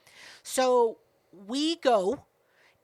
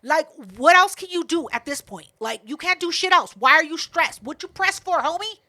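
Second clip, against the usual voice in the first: loud, raised-pitch telling-off; exasperated scolding; 4 semitones higher, 5 dB louder than usual. Retold or imitated. Scolding the rowdy woman at the show, exasperated. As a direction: tear into them, exasperated. Retold quarrel with rowdy table.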